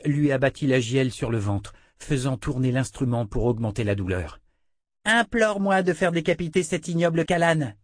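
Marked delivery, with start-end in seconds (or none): 5.1-7.7 high, mocking little-girl voice; cruel child. A narrator's reading. Child princess taunting her captive Richard, high sneering voice.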